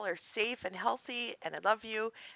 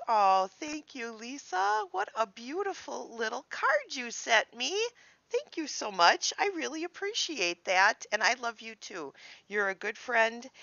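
higher, brighter heartfelt thanks; grateful, sincere thanks to Lisa; gushing thanks; 5 semitones higher, 4 dB louder than usual. Real, not acted. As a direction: thank her like she's right there. Thanking friend on camera.

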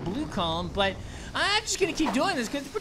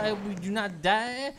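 put-on softer, low French accent; 3 semitones lower, 5 dB quieter than usual. French grape bidding farewell, lightly hammed French accent. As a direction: take your leave grandly, very French. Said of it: "obnoxious French accents".

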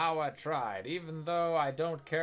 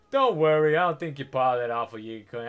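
loud mock reassurance; 9 dB louder than usual. Reading NPC line aloud.

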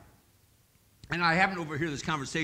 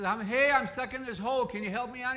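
higher, louder call for help from down a pit; stuck man calling up; 10 semitones higher, 5 dB louder than usual. Acted. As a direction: shout up from the hole, a bit desperate. Man stuck in hole calls up.

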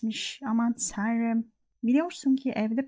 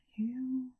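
whispered; 11 dB quieter than usual. Real, not acted. Whispered end of startled phrase.

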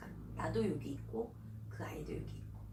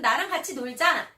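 loud, higher call; shouted slogan; 6 semitones higher, 13 dB louder than usual. Shown as a demonstration. Child practising inviting friends to play, loud shout. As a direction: belt out the invitation. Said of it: "큰 소리로 한 세 번씩".

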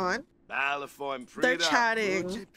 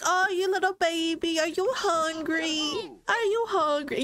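lightly voiced cooing dad, higher pitch; doting baby talk; 5 semitones higher than usual. Lewis voiced asking baby Violet if she's hungry, mild cooing baby talk. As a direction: coo at the baby, a little hammed-up. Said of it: "He's like".

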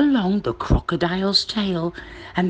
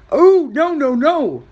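loud, high crocodile protest; 8 semitones higher, 6 dB louder than usual. Acted. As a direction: exclaim a refusal as the crocodile. Crocodile refuses the tooth.

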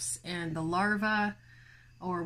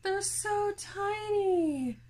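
cooing at bugs; 12 semitones higher, much slower than usual. Real, not acted. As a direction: coo at them like tiny pets. Cooing at tiny mites.

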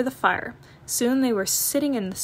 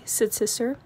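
ordinary delivery

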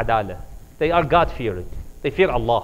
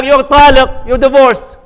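loud, high shouted divorce; angry outburst; 10 semitones higher, 12 dB louder than usual. Retold or imitated. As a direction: bellow it at her in a temper. Husband angrily divorces first wife.

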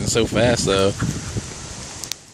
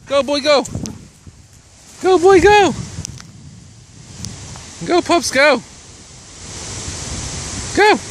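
cheering the dogs on. Real, not acted. Owner cheering her dogs on in the yard, high excited calls. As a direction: whoop them on like a coach at a race.

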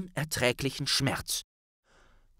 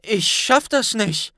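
high, loud frightened cry; scared boy refusing to jump; frightened Harry; 10 semitones higher, 5 dB louder than usual. A narrator's reading. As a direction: say it close to panic. Harry afraid.

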